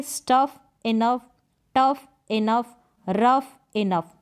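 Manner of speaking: स्टफ, टफ, रफ each paired slowly with इनफ, ending on a clear फ; slow rhyme demo. Shown as a demonstration. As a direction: stretch out each rhyme pair. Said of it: "same है, same sound है". (तुकबंदी धीरे-धीरे बोलना)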